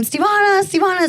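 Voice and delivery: high sing-song calling out a name; parents calling name. Retold or imitated. Parents calling their daughter's name, high sing-song call. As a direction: call her name up high across the house. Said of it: "my parents would always call my name".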